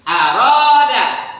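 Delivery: Aroda held slowly and joined firmly; recited demo. Shown as a demonstration. Teacher repeating the reading for the class. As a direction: draw out Aroda in one breath. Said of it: "maka dia harus nyambung bacanya ke tasdid".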